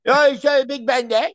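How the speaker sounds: high, exaggerated Cockney cab driver; thick hammed-up Cockney greeting; severe Cockney. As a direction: do an over-the-top chirpy London cabbie in Cockney. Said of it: "he was a severe Cockney".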